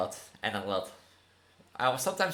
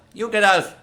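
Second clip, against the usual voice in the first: loud, high yell; shouting; 8 semitones higher, 12 dB louder than usual. Real, not acted. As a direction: shout it as a command. Shouting back at the game.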